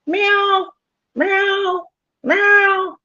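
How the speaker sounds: high squeaky cat meows; high-pitched meow, meow, meow like a cat; imitated cat meows. Shown as a demonstration. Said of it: "like that kind of sound".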